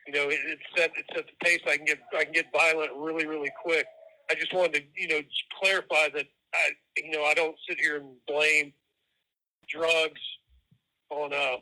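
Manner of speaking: usual speaking voice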